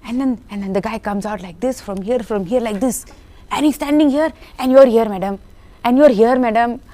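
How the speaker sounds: high, loud, punchy stage directions; director's animated explaining. Retold or imitated. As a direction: act out each position with mounting zeal. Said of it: "So explanation he would give, like, full".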